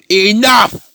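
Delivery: high, loud mock scream; hammed-up retold yell; screamed command. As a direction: shout them all into silence. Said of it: "he's screaming at them to shut up".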